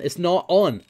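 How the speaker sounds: ordinary delivery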